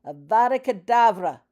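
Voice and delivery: loud, high shouted spell; a witch screaming a deadly curse. A narrator's reading. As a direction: hurl the killing curse at her. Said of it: "pointed her wand at the lady and shouted".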